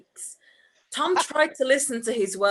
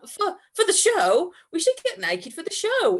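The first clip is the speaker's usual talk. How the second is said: flustered Tom; 10 semitones higher than usual. Acted. Tom covering his slip to Andrew, flustered high voice. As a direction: cover the blunder in a higher panicky voice.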